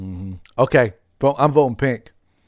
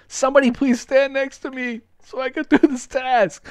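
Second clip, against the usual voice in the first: pleading whine; 12 semitones higher than usual. Real, not acted. Begging teammates to stand close.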